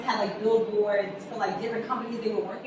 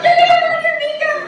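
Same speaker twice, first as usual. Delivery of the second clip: much higher and louder, a mock shriek of alarm; mock alarmed cry; 11 dB louder than usual. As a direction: squeal it like a panicked friend. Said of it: "so they're like".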